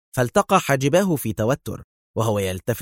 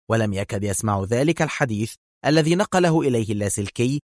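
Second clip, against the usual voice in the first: plain reading voice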